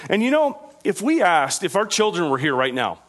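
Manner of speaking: ordinary talk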